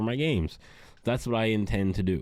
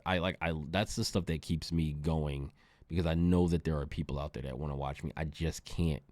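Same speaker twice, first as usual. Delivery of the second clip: ordinary delivery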